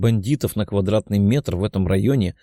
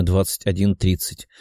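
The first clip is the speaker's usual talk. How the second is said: usual speaking voice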